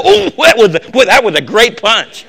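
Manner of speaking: hammed-up admiring boxer; joking praise of a punch; mock boxer praise. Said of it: "I never go".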